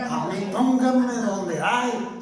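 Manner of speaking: slow, put-on voice of the flesh; greedy flesh voice. Preacher mocking the flesh's appetite, slow put-on voice.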